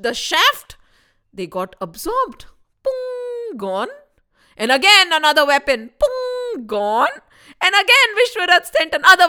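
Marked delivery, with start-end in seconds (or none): 2.8-3.6 a high ringing pung; high pung sound effect. Acted. Weapon hitting the stick and vanishing, high pung sound. 6.0-6.7 a high ringing pung; high pung sound effect. Acted. Weapon hitting the stick and vanishing, high pung sound.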